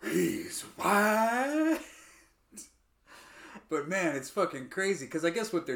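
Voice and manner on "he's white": higher rising disbelief; mock shock. Record execs realising in mock amazement.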